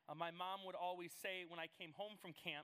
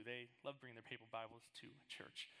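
sarcastic; 6 semitones lower, 5 dB quieter than usual. Real, not acted.

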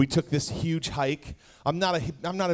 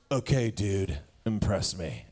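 bored skeptic; 8 semitones lower, 5 dB quieter, slower than usual. Retold or imitated. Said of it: "you're like".

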